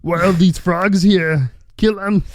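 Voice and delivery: high slow frog voice; menacing Kermit bit. Acted. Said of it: "Kermit the Frog here".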